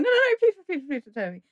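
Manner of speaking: high, frantic mock begging, loud at first; panicked pleading. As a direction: imitate a desperate plea, voice leaping up then dropping. Acts out panicked plea not to tell.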